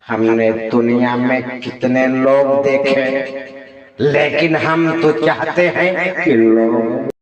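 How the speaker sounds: very slow, stretched-out put-on voice; slow, heavily mannered screen delivery; celebrity-style impression. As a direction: drag each word out theatrically. Mimicry showreel, put-on celebrity voice.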